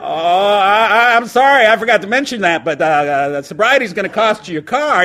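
high, loud fake-sorry God; mock-apologetic God. God adding the car to the bill, mock sorry. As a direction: apologize insincerely while raising the price. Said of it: "And God goes".

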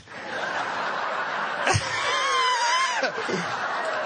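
real laughing outburst; burst of laughter. Real, not acted. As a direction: explode into a laugh. Big laugh at the punchline.

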